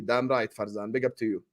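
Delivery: usual speaking voice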